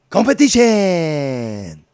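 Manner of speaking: competition! flung out loud and stretched slowly; mocking shout. Real, not acted. Ranting host scoffs at the competition, loud and drawn out. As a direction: scoff the word competition! in one long loud blast.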